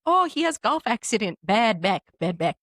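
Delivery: broken English in an imitated accent; masseuse's foreign accent. Masseuse excuses the guy next door, imitated accent. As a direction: put on her accent, choppy phrases. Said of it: "so then she's like".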